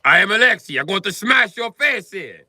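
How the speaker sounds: hammed-up Russian tough guy threatening. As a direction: puff up as Alexei. Acting as tough Alexei.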